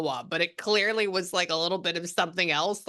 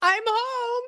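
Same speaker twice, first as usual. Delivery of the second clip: high, bright call coming through the door; mock cheerful greeting; 4 dB louder, much slower than usual. Medium voicing the daughter arriving home, high and loud. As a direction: sing out the homecoming line much higher. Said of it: "she said, be like".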